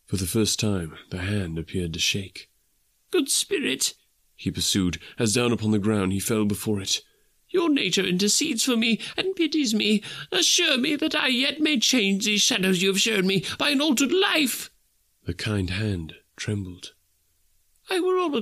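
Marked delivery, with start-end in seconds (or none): 3.1-3.9 put-on high, pleading old miser; Scrooge pleading. A narrator's reading. Scrooge clutching the spirit's robe, crying out in desperate plea. 7.5-14.7 put-on high, pleading old miser; Scrooge pleading. A narrator's reading. Scrooge clutching the spirit's robe, crying out in desperate plea.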